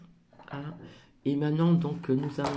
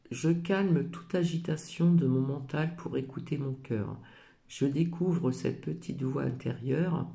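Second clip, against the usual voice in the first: reads the oracle card's message aloud, a little louder and measured; reading a card; 4 dB louder than usual. Acted. Reading her oracle card's message aloud to viewers, gently solemn. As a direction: read the card text as an affirmation.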